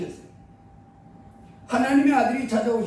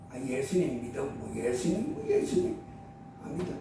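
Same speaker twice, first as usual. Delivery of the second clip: very low, soft, sneering mumble; mocking scoffer; 9 semitones lower, 9 dB quieter than usual. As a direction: sneer at it under your breath. Mocking scoffer, very low then high. (비웃듯 낮게 중얼거리는 말투)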